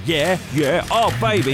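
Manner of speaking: high, loud hammed-up come-on; Austin Powers. Photographer at the photo shoot, loud mock swinger. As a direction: play a swinging photographer egging on the model. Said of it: "it's like Austin Powers".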